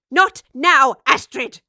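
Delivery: loud sharp snap; the professor snaps at Astrid to wait; snapped. Acted. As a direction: bite it off hard. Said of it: "snapped the professor".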